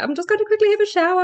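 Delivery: hammed-up breezy husband; mock husband. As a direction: send up the husband's calm. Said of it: "And he's like".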